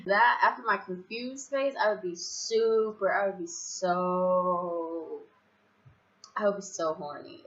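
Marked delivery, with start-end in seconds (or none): 1.8-5.2 very slow, stretched-out emphasis; drawn out. Real, not acted. Telling of the stage after confusion, drawn out. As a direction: hang on each last word. Teasing build-up to next stage.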